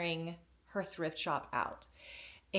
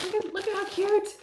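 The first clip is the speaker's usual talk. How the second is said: much higher, louder squeal of delight; 13 semitones higher, 6 dB louder than usual. Real, not acted. Gushing over the gift from her friend, squealing with delight. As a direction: gush over it like it's adorable.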